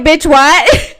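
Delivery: loud stunned yell, pitch jumps up; retelling her shocked scream at a friend; shocked shout. Retold or imitated. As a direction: blurt it out in disbelief. Shocked reply to friend's confession.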